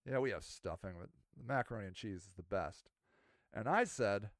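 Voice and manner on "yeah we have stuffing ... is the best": low, lightly voiced park ranger. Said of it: "He's like".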